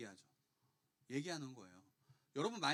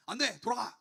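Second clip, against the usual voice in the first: loud, raised scolding shout; thundering rebuke; 6 semitones higher, 9 dB louder than usual. Retold or imitated. Judge from a viral clip dismissing someone, scolding loudly. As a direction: imitate a judge roaring a refusal. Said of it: "막 이러면서 막 그 호통치는 거 봤어요?".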